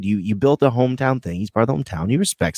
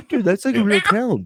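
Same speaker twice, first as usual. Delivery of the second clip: laughing tease; 11 semitones higher than usual. Real, not acted. Laughing high teasing.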